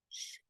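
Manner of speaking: "Sh" as a voiceless, quiet hiss. Shown as a demonstration. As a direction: breathe a quiet "Sh" with voice off. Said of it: "this is a quiet sound".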